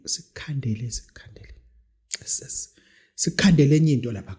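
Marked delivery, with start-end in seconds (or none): 0.1-2.7 hushed whisper. Real, not acted. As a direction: whisper it secretly. Secretive ritual talk.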